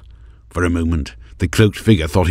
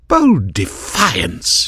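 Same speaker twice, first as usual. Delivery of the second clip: cried out; 6 semitones higher, much slower than usual. A narrator's reading. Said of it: "she cried".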